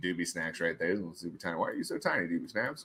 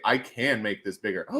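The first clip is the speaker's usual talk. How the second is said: ordinary talk, nothing marked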